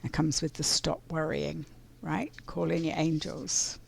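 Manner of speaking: ordinary talk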